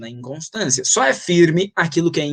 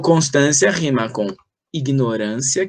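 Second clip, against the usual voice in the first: ordinary delivery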